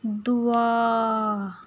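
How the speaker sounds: दुआ stretched very long; दुआ slowly drawn out; drawn-out word. Shown as a demonstration. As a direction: hold दुआ slowly for the class. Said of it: "फिर है दाल से".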